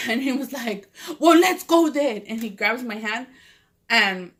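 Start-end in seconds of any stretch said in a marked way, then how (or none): 1.2-2.2 high, loud, slow retold command; insistent retort. Vato takes her away from the other man, insistent. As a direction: act out an impatient order. Imitating Vato's insistent answer.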